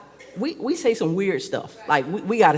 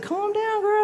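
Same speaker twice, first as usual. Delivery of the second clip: high-pitched placating imitation; mock soothing. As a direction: mimic a friend trying to calm someone down. Imitating people who soothe her.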